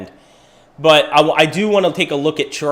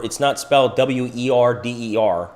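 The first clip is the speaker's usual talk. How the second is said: usual speaking voice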